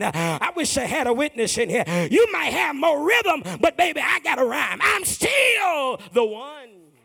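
rising shouted sermon climax; whooping preacher declaration; preaching at full force. Real, not acted. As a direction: preach it loud, stretching the end.

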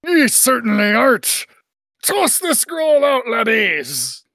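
pirate captain barking an order, pitch jumping up; captain ordering his crew to toss a stranger. Acted. Captain orders him thrown out.

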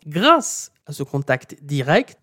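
ordinary talk

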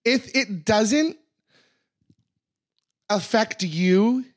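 drawn-out careful wording; slow down to choose each word; slow and deliberate. Real, not acted. Co-host carefully naming her flaw, slowly.

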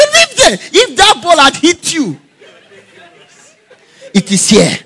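high, loud mock scolding of a schoolboy; scolding house master. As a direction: play the house master warning the boy, half laughing. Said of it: "they wanted to say".

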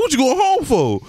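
high-pitched retold disbelief; mock baffled protest. Boyfriend asking his girlfriend why she is leaving, mock baffled protest. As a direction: retell a puzzled objection, voice jumping up.

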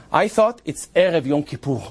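normal talking voice

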